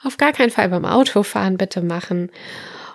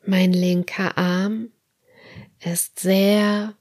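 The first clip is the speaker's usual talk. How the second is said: guided meditation; slower than usual. Acted. Leads autogenic training relaxation.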